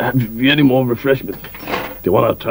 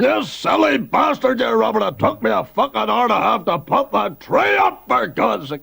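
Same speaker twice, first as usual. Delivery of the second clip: exasperated yelling; 9 semitones higher than usual. Acted. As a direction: rage at the clumsy guest. Host furious about the tree.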